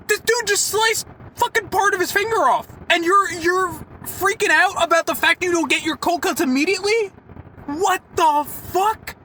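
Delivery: high-pitched, exasperated retold rant; indignant telling-off. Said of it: "start yelling at her".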